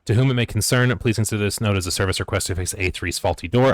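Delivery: loud, formal read of a service request; formal letter reading. Acted. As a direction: declaim it like a stiff official letter. Reads out in-game note.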